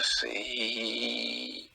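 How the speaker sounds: painfully slow, each letter dragged out; slow writing. Retold or imitated. Imitating old comic slowly signing autograph, very slow drawn-out letters. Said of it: "he was really slow".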